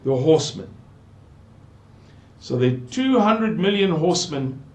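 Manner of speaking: ordinary talk, nothing marked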